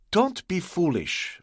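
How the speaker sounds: slow, sharp rebuke; sharp warning. A narrator's reading. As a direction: warn him off sharply. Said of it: "Ah Wong said sharply".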